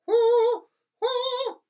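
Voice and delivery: very high, loud head voice; head voice whoo. Shown as a demonstration. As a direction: float it up into head voice. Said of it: "it's just a head voice".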